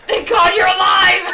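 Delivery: laughing, shouted relief; relieved shout. Real, not acted. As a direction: yell it out with a laugh. Relief Sayori lives.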